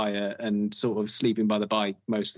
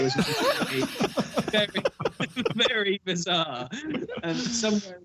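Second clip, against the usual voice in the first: helpless laughter through the words, higher pitch; laughing; 9 semitones higher, slower than usual. Real, not acted. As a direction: crack up while trying to finish. Cracking up at own story.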